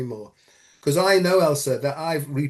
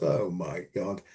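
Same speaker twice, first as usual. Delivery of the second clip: moved; 8 semitones lower, 7 dB quieter, slower than usual. Retold or imitated. Moved by friend's final gift.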